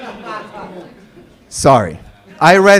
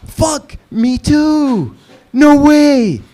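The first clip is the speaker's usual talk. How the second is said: excited; 7 semitones higher, much slower than usual. Retold or imitated. Excited Lebanese guy in dialogue.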